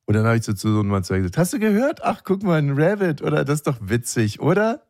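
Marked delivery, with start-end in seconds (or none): 1.4-4.8 high, chirpy parent voice; mock enthusiasm. Parent sells rabbit joke to bored son, high mock delight. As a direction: imitate a parent overselling a joke to a child.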